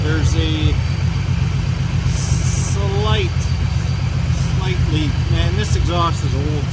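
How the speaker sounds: talking loud from under a car; lying under a car. Real, not acted. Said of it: "I'm under the car".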